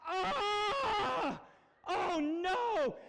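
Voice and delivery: high comic wail of dismay; mock horror. Dad looking at his daughter's picture, mock horrified. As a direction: cry out in hammed-up horror.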